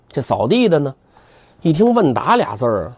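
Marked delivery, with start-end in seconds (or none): none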